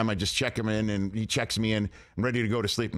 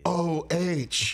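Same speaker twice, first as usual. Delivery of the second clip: retold yell; 5 semitones higher than usual.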